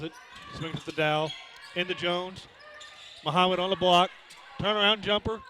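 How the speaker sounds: brisk live game call; play-by-play. Real, not acted. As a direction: call the action as it happens.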